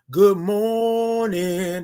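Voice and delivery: very long, held GOOD MORNING; slow sing-out morning call; drawn-out greeting. Shown as a demonstration. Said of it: "That's what I'm talking about".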